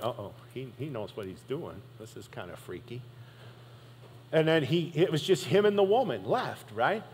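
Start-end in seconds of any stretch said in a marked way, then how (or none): 0.0-3.0 much softer, nervous hushed aside; spooked mock mutter. Accusers back away, spooked.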